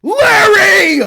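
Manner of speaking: high, full-volume scream of the name; exasperated scream. Real, not acted. Streamer yelling at Larry in the game, exasperated scream.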